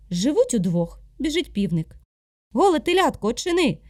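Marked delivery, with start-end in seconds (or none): 2.5-3.8 high, louder rooster call. Acted. Rooster calling to be let in, loud. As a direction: call out sharply at the door.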